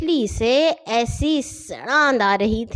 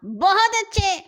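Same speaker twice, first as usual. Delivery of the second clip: high mock cheer at fighting men; 9 semitones higher than usual. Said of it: "حسن نے زور سے کہا".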